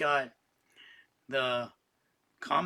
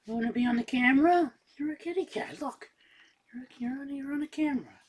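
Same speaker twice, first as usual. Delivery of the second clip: high, coaxing cat voice, higher than usual; high pet talk; 9 semitones higher, faster than usual. Real, not acted. Owner coaxing his cat Dexter onto camera, high sweet pet talk.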